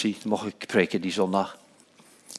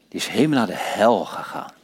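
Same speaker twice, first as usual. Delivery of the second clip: usual speaking voice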